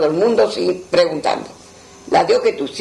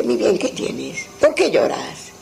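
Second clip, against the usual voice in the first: tender comfort; 4 dB quieter, slower than usual. Retold or imitated. Comforting a weeping young man.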